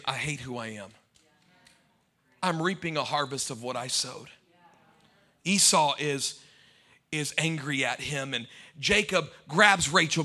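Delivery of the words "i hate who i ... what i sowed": low, much softer, self-loathing; despairing. Retold or imitated. Jacob admits to himself he hates who he is, low and soft. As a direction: sink into quiet despair. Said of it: "he was saying".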